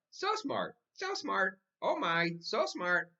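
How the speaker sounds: high bird voice copying Grandma; mynah bird mimicking. A narrator's reading. The bird repeating Grandma's praise, high mimicking voice. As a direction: parrot her words back. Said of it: "Uncle Feather said".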